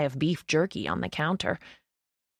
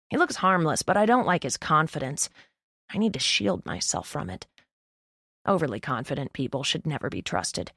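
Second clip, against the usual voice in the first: ordinary delivery, nothing marked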